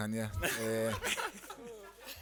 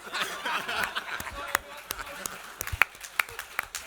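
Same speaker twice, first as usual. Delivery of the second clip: laughing; 12 semitones higher than usual. Real, not acted. Laughs at the heckler.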